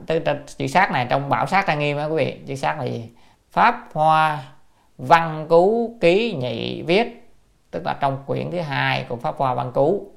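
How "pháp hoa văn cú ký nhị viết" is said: slow, projected classical reading; formal citation. Real, not acted. Teacher cites the commentary by volume, slow formal recitation. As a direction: announce the source word by word.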